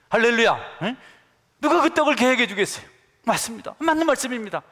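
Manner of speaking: full-force revival preaching. Real, not acted. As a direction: drive the point home to the congregation.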